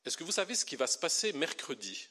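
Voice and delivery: ordinary talk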